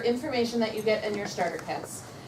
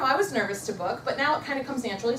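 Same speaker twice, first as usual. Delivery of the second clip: ordinary talk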